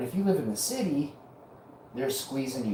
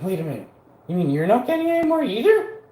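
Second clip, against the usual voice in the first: high, fast mock-shocked voice; mocking follower; 7 semitones higher, 4 dB louder, faster than usual. Vlogger mocks vaccinated followers in a high dim voice. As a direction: squeak it like a dim follower catching on. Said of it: "So you're like".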